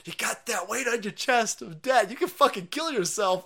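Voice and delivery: normal talking voice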